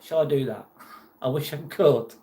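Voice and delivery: ordinary delivery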